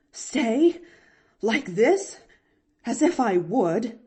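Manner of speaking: scornful refusal. A narrator's reading. Aunt tearing down her hairdo, scornfully refusing. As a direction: throw it back with loud scorn.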